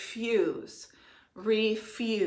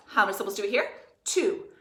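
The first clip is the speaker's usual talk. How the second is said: ordinary talk, nothing marked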